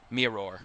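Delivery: Mirror said slowly in a Northern Irish accent, r sounds rolled into each other. Shown as a demonstration. Commentator tries the hard word in a Northern Irish accent. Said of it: "worst words to say in a Northern Irish accent is a mirror".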